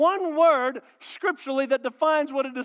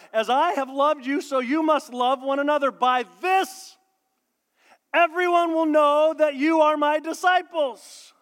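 loud, slow proclaiming; preaching; 4 semitones higher, 5 dB louder than usual. Acted. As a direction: proclaim the verse with weight. Proclaims scripture.

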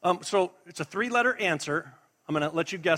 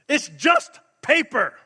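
loud, high, slow declaration; forceful preaching; 5 semitones higher, 6 dB louder than usual. Real, not acted. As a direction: proclaim each phrase with weight and pauses. Preacher makes a forceful, spaced declaration.

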